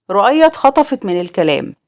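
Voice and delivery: ordinary delivery, nothing marked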